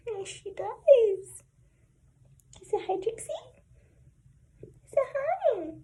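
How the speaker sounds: high, slow sing-song pet talk; talking to a dog. Real, not acted. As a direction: coo at the puppy in a high voice. Praising her dog after its bath.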